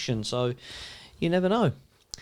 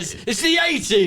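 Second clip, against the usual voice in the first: higher proud reveal; boss impression; 8 semitones higher than usual. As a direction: show off the picture. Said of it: "And he turns around".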